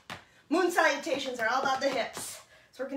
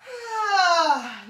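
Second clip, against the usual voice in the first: loud releasing exhale; 6 semitones higher, 7 dB louder than usual. Shown as a demonstration.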